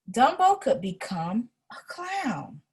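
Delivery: plain reading voice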